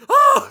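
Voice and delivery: very high, loud mock shriek; funny-sounding scream. Imitating the Texas Chainsaw victim, comic high scream. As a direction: imitate a blood-curdling scream for laughs. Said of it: "it's such a funny sounding scream".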